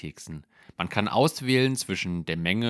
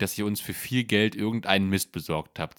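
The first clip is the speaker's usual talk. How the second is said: usual speaking voice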